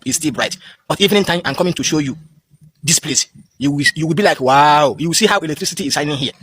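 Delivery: ordinary talk, nothing marked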